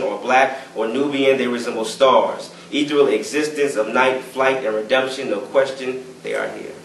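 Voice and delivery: driving lists, each phrase leaning on its first beat; spoken-word poem. Acted. Poet reading from his manuscript Sketches of Revolution, spoken-word delivery. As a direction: perform it as rhythmic, incantatory verse.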